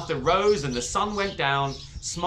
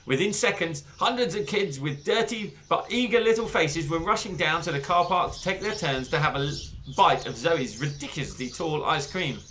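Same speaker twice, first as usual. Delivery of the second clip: ordinary delivery, nothing marked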